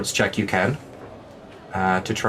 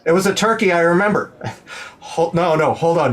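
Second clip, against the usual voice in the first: excited correction; 7 semitones higher than usual. Real, not acted.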